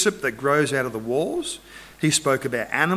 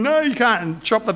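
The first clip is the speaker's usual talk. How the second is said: mock distressed plea, pitched high; retold weeping plea; 6 semitones higher than usual. Mother begging for her baby's life, imitated high plea. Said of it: "one woman starts weeping".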